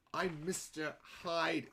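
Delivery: drawn-out, odd celebrity impression; John Malkovich. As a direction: imitate him, stretching every word. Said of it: "Nobody else talks like that".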